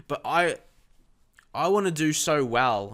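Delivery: ordinary talk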